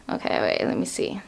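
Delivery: hushed, breathy mutter; whispered aside. Real, not acted. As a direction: whisper to herself while fixing the camera. Adjusting camera, muttering aside.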